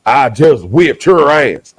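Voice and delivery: slow, loud mock wrestler gloat; wrestler's boast. Friend jokes about the verse as a slow, loud wrestler boast. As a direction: bellow it like a pro wrestler. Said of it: "what Austin three sixteen means".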